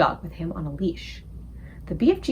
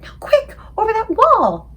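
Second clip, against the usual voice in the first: urgent whisper; 11 semitones higher, 7 dB louder than usual. A narrator's reading. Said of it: "Sophie whispered".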